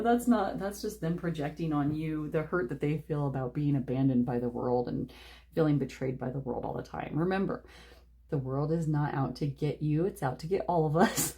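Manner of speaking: ordinary talk, nothing marked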